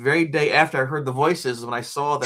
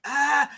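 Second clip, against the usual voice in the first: high-pitched mock scream; short cry of alarm, imitated; frightened yelp; 9 semitones higher than usual. Turning to find the ghost there, frightened yelp.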